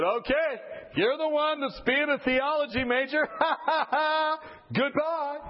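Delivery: high, gleeful taunt with laughter; mocking send-off. Staff sending the theology major in, high mocking laughter. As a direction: laugh as you send him in.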